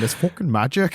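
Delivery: put-on light, rough stab at a Midlands accent; attempted Midlands accent. Podcaster trying out a catchphrase, attempted Midlands accent. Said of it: "'cause you just went Northern, not Midlands".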